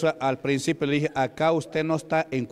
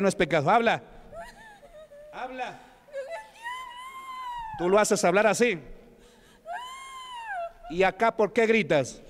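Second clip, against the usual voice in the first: insistent questioning, rising higher as it goes on; exorcist interrogation; 8 semitones higher than usual. Real, not acted. Preacher questioning the devil in a woman, insistent interrogation. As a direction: demand answers from the demon, pressing harder.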